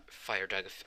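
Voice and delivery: plain reading voice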